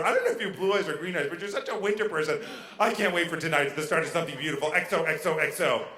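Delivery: rattled-off, run-on imitation of a texting date; breathless rambling. Date texting a long message before the first date, rambling. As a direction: imitate a chatterbox rushing through every tangent. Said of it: "I got the longest first text message".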